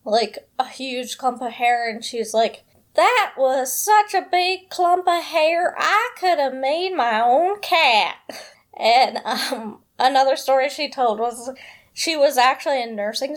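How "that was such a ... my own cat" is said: higher, slow southern drawl imitating the maintenance lady; southern accent impression. Vlogger imitates the maintenance lady's southern storytelling, slow and higher. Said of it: "attempt to do an impression of her".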